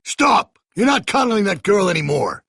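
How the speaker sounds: a loud, high barked stop; sudden commanding shout; sharp shout. Acted. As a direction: cut them off with one yell. Stopping the punishment, commanding.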